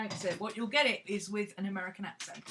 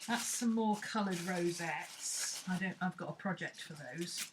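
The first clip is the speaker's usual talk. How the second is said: ordinary delivery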